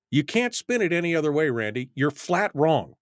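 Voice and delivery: ordinary talk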